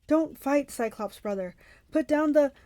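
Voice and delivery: mildly acted urgent appeal; urgent plea. A narrator's reading. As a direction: plead loudly to stop the fight. Tyson pleads with attacking Cyclops.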